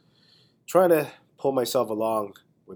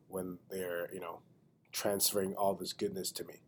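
whisper; 3 semitones lower, 9 dB quieter than usual. Real, not acted. Joking aside about germs.

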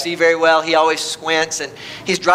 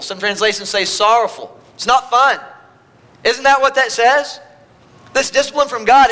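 ordinary talk, nothing marked

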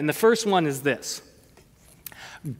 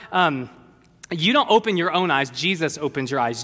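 usual speaking voice

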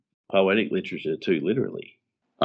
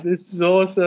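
high, loud delighted exclaim; excited; 11 semitones higher, 7 dB louder than usual. Retold or imitated. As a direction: gush about it. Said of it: "I was like".

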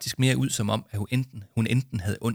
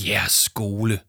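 scornful, disdainful repeat; scornful snort; 3 dB louder, slower than usual. Acted. School leader mocking Jama's words, scornful. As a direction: sneer at the kids' claim. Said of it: "fnøs han hånligt".

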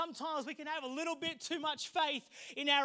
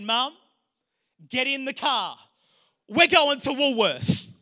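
calling to mum; 11 dB louder than usual. Retold or imitated. Teen son loudly announcing the drive to his mum. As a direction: announce the grocery trip like a grand adventure. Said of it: "I looked at my mum and I said".

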